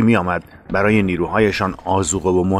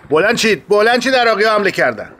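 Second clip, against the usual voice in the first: higher and louder, calling everyone awake; alarmed shout; 12 semitones higher, 3 dB louder than usual. Acted. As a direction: yell the alarm to the sleeping fighters. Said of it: "داد زدم". (فریاد هشدار به رزمندگان خواب)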